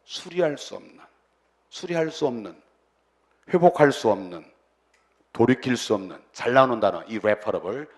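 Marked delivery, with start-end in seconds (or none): none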